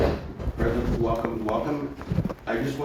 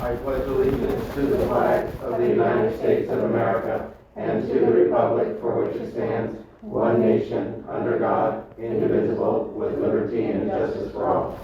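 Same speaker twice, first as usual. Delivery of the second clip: pledge recitation; 6 dB louder than usual. Real, not acted. Leads the flag pledge.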